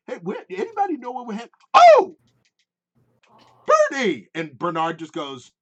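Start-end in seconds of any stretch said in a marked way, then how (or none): 0.1-4.3 high, fast, then a loud yelp of recognition; excited shout. Acted. Gnome spotting Bernard, hollering his nickname. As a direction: spot an old friend and holler. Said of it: "And he's just like".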